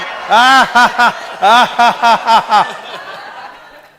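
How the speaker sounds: long, high, loud forced laughter; loud fake laugh. Shown as a demonstration. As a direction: laugh hard on purpose with full effort. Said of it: "Fake laugh".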